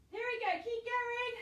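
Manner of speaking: pushing through the burn, loud; strained encouragement mid-hold; straining with effort. Real, not acted. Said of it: "shaking so much on that leg".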